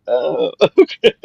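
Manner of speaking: slow, awkward okay with a laugh; Lynette, awkward, laughing it off. Retold or imitated. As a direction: laugh it off uneasily. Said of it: "She's like".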